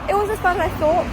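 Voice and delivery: excited relief. Real, not acted. Day over, relieved and free.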